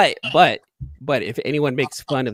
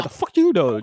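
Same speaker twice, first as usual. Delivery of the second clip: loud, higher mock New York retort; mock New Yorker; 6 semitones higher, 5 dB louder than usual. Co-host echoing his friend's retort, mock New Yorker. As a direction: throw it back like a brash New Yorker. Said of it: "just very New Yorkerish".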